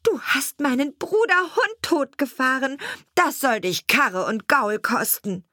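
high-pitched outraged sparrow; accusing cry. A narrator's reading. Sparrow to the carter who killed his dog, high accusing cry. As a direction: call out the accusation and threat, pitched well up. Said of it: "Da rief der Sperling".

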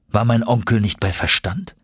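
usual speaking voice

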